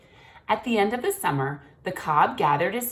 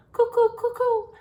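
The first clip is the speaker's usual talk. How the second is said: high, louder hooting owl call; 6 dB louder than usual. A narrator's reading. Father swan imitating the little owl, high hoots.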